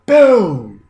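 loud "Boom!" punched out. Retold or imitated. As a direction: slam the word like the punch. Punch closes his eye.

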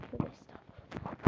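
muttered whispered prayer; hushed pious whisper. Real, not acted. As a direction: whisper it under your breath. Whispered prayer after scare.

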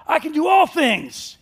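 slow, high, booming "I can do all things"; big poster letters. Shown as a demonstration. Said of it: "it looks something like this".

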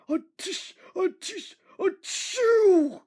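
rising high, exploding loud sneeze; big sneeze. A narrator's reading. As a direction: build up and burst into a sneeze. Henry sneezes at the bridge.

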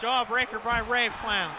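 high, excited play-by-play calling; wrestling commentator. Acted. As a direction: call the big moves hyped up, ringside. Live play-by-play of a wrestling match.

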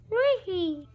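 dinosaur voice. Pretend. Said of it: "I think it's saying Trixie in dinosaur voice".

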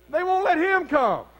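slow, loud disbelieving echo; incredulous repeat. Retold or imitated. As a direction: repeat it like you can't believe it.

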